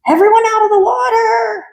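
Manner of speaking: very high, loud shrieking alarm; panicked shout. Acted. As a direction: scream the warning in terror.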